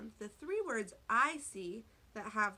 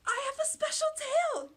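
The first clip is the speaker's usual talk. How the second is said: high, loud excited yell; 8 dB louder than usual. A narrator's reading. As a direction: yell it out proudly, much higher. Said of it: "yelled Ray".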